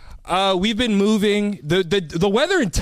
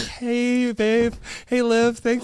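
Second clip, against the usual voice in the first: mock normal voice; 4 semitones higher, slower than usual. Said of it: "She thinks you talk like this".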